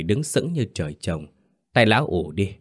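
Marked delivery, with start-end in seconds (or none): none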